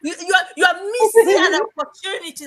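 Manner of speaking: mock aunt, high and loud through laughter; laughing aunt voice. Aunt scolds niece over missed opportunity, laughing high. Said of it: "my aunt was like".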